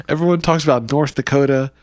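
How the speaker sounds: ordinary delivery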